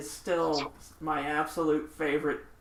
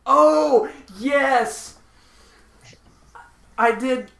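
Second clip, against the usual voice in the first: loud, high burst of delighted recognition; real outburst as the memory hits; excited recall; 8 semitones higher, 9 dB louder, much slower than usual. Real, not acted. Storyteller to friend on the missed detail, excitedly.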